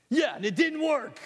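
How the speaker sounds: high, loud complaint; George, fed up. Acted. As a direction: let him have it.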